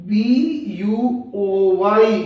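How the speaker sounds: usual speaking voice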